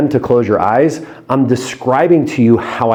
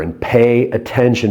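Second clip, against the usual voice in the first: ordinary talk, nothing marked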